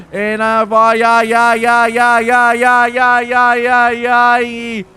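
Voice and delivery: loud repeated ay-ay-ay cries; rapid excited whooping. Real, not acted. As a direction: rattle off a rhythmic string of whoops. Commentator whoops over great racing.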